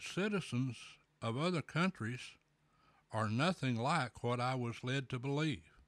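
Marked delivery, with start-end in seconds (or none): none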